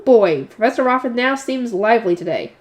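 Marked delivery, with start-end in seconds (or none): none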